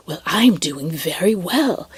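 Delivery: slow, drawn-out, contented; lightly voiced cheerful clerk; pleased clerk voice. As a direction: savour how well he's doing. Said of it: "sweet, nice voice".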